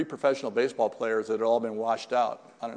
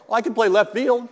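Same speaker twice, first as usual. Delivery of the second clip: higher and louder, pushing his case; insistent boast; 9 semitones higher, 8 dB louder than usual. Retold or imitated. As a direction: sell yourself eagerly to the coach. Retold boast to the coach.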